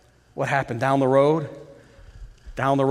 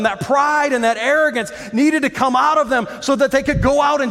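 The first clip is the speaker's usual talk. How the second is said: preaching at full force; 5 semitones higher than usual. Acted. Builds into loud preaching.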